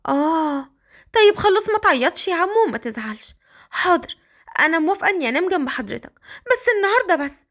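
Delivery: put-on Qadr, high girlish voice. The girl complaining to Raad his clothes don't fit, high girlish voice. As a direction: speak up high like a shy young girl.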